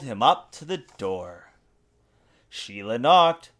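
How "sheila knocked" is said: ordinary delivery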